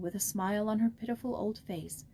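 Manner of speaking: usual speaking voice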